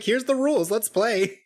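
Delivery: cheerful villain quote. Retold or imitated. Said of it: "He's just like".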